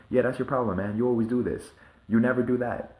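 hammed-up blaming tone; mock argument. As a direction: throw it at them like a heated partner in a fight. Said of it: "people say this in arguments all the time".

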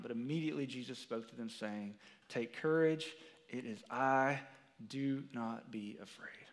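low, soft and reverent, the words weighed; solemn scripture reading. Acted. Preacher reading Jesus' words to congregation, hushed and solemn. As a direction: read it like holy writ. Reads Matthew's parallel verse.

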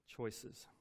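usual speaking voice

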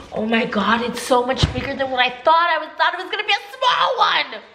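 high, delighted disbelief at the size; rising up high in happy shock; surprised. Real, not acted.

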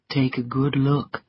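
lightly voiced man, pitched low; low, understated irony; a hint of a cynical gentleman; sardonic Rhett. Rhett sardonic about beaten soldiers.

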